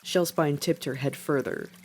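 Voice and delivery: plain reading voice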